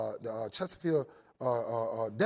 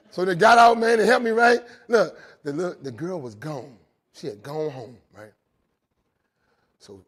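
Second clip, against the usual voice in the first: ordinary delivery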